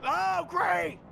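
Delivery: loud, high sarcastic yell; frustrated shout. Real, not acted. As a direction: blurt it out in disbelief. Teammate has no defib.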